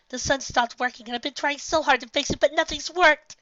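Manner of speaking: high, rising whine; a distraught filly explaining; groaning complaint. A narrator's reading. Filly explains to Nightmare Moon, high groaning whine. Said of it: "she groaned".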